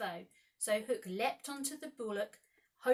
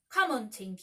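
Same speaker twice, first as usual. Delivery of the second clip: narrated call; 8 dB louder than usual. A narrator's reading. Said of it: "he cried".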